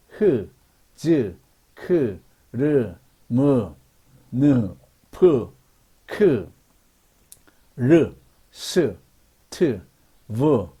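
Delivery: "흐, 즈, 크" and the rest, each a separate falling sound; isolated consonant sounds. Shown as a demonstration. Teacher reads consonant symbols to learners, each sound separately from "흐" to "즈". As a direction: sound out each consonant alone, "흐" through "즈", with pauses. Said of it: "그 음가가 그대로 발음기호입니다".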